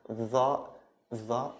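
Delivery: open-throated TH-Z blend; "dha" as in Modern Standard Arabic; standard emphatic "dha". Shown as a demonstration. Teacher returning to standard pronunciation, emphatic "dha". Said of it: "this is the standard pronunciation".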